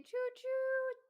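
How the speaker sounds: high, loud "choo choo"; playful train toot, long and high; train whistle call. Pretend. Train whistle cheer welcoming member.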